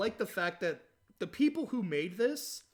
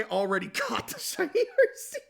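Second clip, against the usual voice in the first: high-pitched, louder, laughing through the words; laughing confession; 12 semitones higher, 5 dB louder than usual. Real, not acted. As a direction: crack up as you admit it. Laughs revealing he already caught it.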